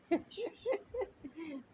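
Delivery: soft, high chuckle. Real, not acted. Teacher amused after quoting "Iti Shushruma", softly chuckling. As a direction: laugh softly and high to yourself.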